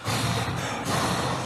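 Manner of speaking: heavy, noisy breaths into the microphone; Darth Vader breathing. Acted. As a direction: breathe like a scared speaker at the mic. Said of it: "Darth Vader's getting ready to bear his testimony".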